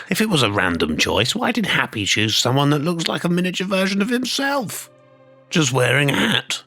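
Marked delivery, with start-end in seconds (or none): 1.3-4.9 incredulous challenge rising higher and higher; indignant incredulity. Acted. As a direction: pitch climbing steadily to a high peak. Indignant challenge to rival's account.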